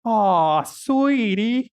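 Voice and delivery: high, loud, drawn-out cooing; syrupy high condescension; pitying receptionist. Retold or imitated. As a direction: coo it like pitying a small child. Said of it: "the receptionist turns her head and looks".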